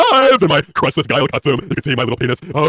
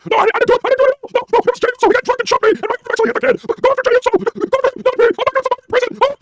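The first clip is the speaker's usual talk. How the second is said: high, pleading whine throughout; mock Heyman panic. Heyman pleads with the lawyer, high panicked whine. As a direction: beg the court in a squeaky panic. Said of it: "K J V says impression".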